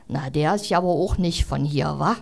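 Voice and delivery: a hint of a suspicious busybody; grumpy old neighbour.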